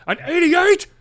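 high, loud, outraged question; shocked mom. Retold or imitated. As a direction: mom appalled at a B grade.

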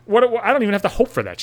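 ordinary delivery

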